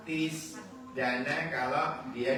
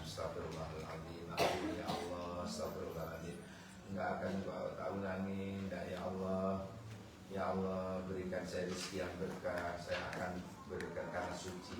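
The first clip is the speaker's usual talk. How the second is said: doa pelan dan lirih memohon ampun; contoh doa lirih; 7 semitones lower, 10 dB quieter than usual. Shown as a demonstration. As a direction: bisikkan istighfar dengan khusyuk. (doa istighfar yang lembut dan pelan)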